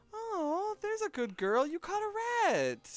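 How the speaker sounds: put-on high gushing baby talk. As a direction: coo at the kitty. Passerby fawning over cat.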